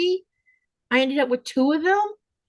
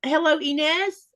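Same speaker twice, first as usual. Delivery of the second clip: usual speaking voice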